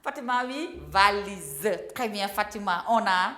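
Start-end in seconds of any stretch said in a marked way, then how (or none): none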